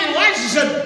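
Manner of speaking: ordinary delivery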